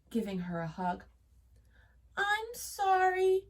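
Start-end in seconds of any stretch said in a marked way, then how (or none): 2.2-3.5 high, slow apologetic voice; sorry little girl. A narrator's reading. Goldilocks apologises to her parents, high and meek. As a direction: apologise meekly as a child. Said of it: "said Goldilocks".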